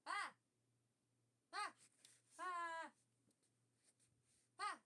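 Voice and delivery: short loud panicked yelps; panicked yell. Real, not acted. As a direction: yell out in alarm. Panics that stream audio is quiet.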